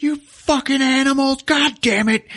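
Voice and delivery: high acted furious yell; enraged cursing. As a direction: curse them out as an enraged guard. Guard curses at the apes.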